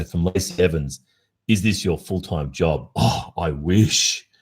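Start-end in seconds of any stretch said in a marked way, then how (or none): none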